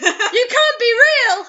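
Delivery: slow, high, loud and laughing-crying; mock horror wail, cracking up; terrified disbelief. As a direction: wail it in hammed-up terror. Dramatic reading of horror line.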